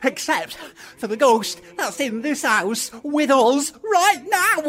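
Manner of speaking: high, rising frightened wail; panicked protest. Acted. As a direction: blurt it out terrified of the ghost. Frightened of ghost in the house.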